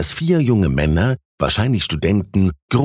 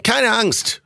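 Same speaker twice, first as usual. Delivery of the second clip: put-on high, light young man's call; reassuring young man. A narrator's reading. Most sober student calming hesitant Thies, high-pitched reassurance. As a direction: reassure him in a raised, lively pitch.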